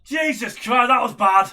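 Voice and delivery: loud shocked outburst; loud exclamation. Real, not acted. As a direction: yell out in disbelief. Reacting to bad line.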